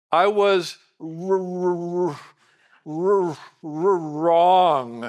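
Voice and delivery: stuck on the r, dragging it out slowly before forcing out wrong; mock stammer, unable to admit it; struggling to say wrong. Father choking on admitting he was wrong, slow halting stammer. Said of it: "struggle like I have to go".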